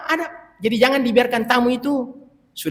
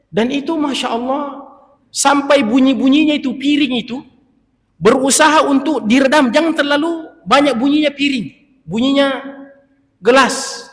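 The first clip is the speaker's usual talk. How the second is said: higher and louder with enthusiasm; raised, admiring tone; excited; 6 semitones higher, 4 dB louder than usual. Real, not acted. Excited about muffled dishes.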